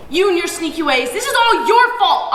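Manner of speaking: high, loud, slowing accusation; growl. A narrator's reading. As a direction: hammer the blame home. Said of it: "Appa growls".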